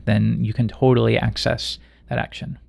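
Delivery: ordinary delivery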